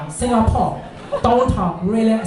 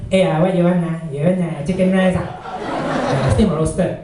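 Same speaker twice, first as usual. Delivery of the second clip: casual hawker-stall Singlish; heartlander Singlish; 2 semitones lower than usual. Retold or imitated. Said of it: "hawker center, heartlander type".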